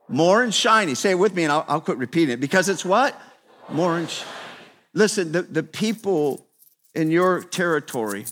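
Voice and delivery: normal talking voice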